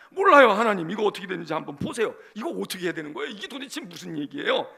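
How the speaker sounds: loud, imploring prayer; exasperated plea. Acted. Believer handing a problem to God, exasperated.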